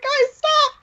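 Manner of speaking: high-pitched mock yell; retold shout. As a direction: call the group to order, high and urgent. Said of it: "shouting all at once".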